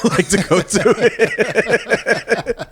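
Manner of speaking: slow words breaking into high laughter; giggling out the last words; laughing. Retold or imitated. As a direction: laugh through the end of the line. Laughing through friend's quote.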